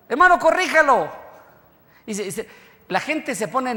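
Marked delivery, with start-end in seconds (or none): 0.1-1.1 high, loud mock alarm at the map; mock alarmed outburst. Preacher mimicking a shocked listener, loud and high. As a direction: blurt it out like a startled churchgoer. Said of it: "la reacción de uno es".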